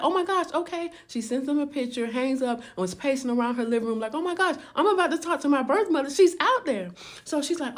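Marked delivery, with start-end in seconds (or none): 0.0-0.9 higher, eager squeal; can barely contain herself; excited. Retold or imitated. Daughter after sending the picture, excited. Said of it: "she was so excited". 4.1-6.9 higher and fast, eager; rushing the words, giddy; giddy pacing. Retold or imitated. Pacing around the living room, giddy and fast.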